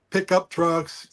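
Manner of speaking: ordinary talk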